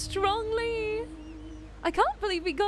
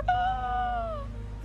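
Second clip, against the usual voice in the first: sharp gasp; 10 semitones higher than usual. Real, not acted. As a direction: gasp in disbelief. Reacting to escape news.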